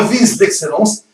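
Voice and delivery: normal talking voice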